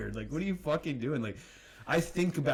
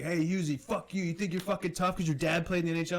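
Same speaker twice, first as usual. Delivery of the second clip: mock confrontation; 5 semitones higher than usual. Mocking guy confronting him.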